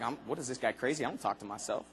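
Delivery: low, quiet mock-scoffing listener; skeptical audience voice. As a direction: mutter it like a doubter in the crowd. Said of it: "looking at me right now like".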